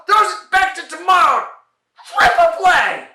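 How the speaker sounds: high, loud yell; frantic shout. Acted. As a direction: shout it out at full throttle. Frantic shout in comedy routine.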